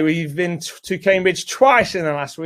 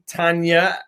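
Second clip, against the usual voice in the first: normal talking voice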